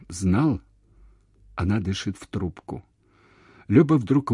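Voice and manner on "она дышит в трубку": plain reading voice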